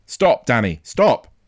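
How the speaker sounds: loud, urgent warning; shout. A narrator's reading. Father warning his running son, shouting. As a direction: shout to halt someone at once. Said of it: "he shouted".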